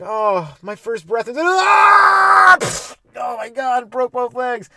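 hammed-up baby giraffe falling; newborn giraffe. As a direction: narrate your own plunge in panic.

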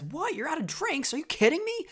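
high-pitched incredulous character quote; Kaz. Retold or imitated. Co-host quoting Kaz at the bar, high incredulous voice. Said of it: "and Kaz says".

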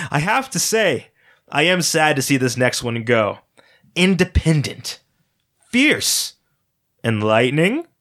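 affectionate, playful host voice; fond showman. Acted. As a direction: tease the crowd with a loving smile. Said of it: "with a genuine loving smile".

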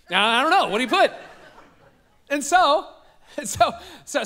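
laughing at his own younger self; amused, laughing aside. Real, not acted. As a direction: chuckle through the words. Laughs at himself.

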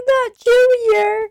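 very high, slow wail; mock anguish. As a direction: wail it, heartbroken. Grieving Junior's death.

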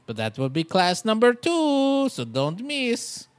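hammed-up class-teacher accent; put-on accent. Mock teacher plugging class number two, in a hammed-up accent. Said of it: "I don't know why I did that accent".

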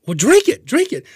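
high, loud mock bartender egging him on; hammed-up high-pitched urging. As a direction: push him to drink it in a squeaky put-on voice. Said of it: "the guy goes".

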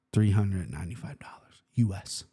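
hushed, low whisper of the price; whispered price reveal; dramatic whisper. Real, not acted. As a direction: breathe the number out like a secret.

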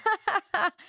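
high burst of laughter; quick, high imitation laugh; re-enacted laugh. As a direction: act out cracking up at a joke. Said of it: "when I heard the joke before that, I was like".

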